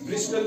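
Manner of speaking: ब्रिस्टल with a clear T before L; T pronounced. Shown as a demonstration. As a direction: put the T into ब्रिस्टल. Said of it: "नहीं पढ़ते हैं".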